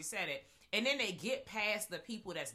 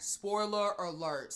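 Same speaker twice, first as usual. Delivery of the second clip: announcer voice; 2 semitones higher, 5 dB louder, slower than usual. Shown as a demonstration. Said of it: "let's give them the spoiler alert".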